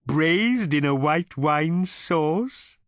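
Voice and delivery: high, very slow, courteous cow voice; cow character. A narrator's reading. Cow recommending a sauce to diners, in a high, very slow courteous voice. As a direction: savour the suggestion like a waiter.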